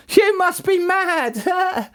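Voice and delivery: very high, drawn-out mocking cry; jeering. A narrator's reading. Villagers mock the farmers from the crater edge, jeering. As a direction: scoff slowly in a high squawk. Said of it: "The people jeered and laughed".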